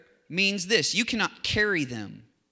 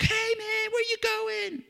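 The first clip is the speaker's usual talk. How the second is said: very high, put-on buddy voice; imitating a friend; slower than usual. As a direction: greet a pal in a mock high voice. Acts out friend at airport.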